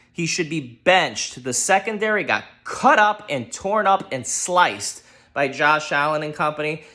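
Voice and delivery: ordinary talk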